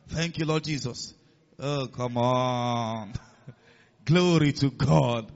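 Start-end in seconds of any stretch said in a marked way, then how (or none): 1.6-5.3 drawn-out, relishing praise; savoring exclamation. Real, not acted. Preacher exulting to his congregation over the verse, savoring exclamation. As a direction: stretch each word out slowly.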